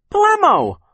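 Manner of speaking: very high, loud little shriek; crying out. A narrator's reading. Little Sonny joins in, crying out in a high shriek. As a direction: squeal it out at the top of the range. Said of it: "Sonny cried".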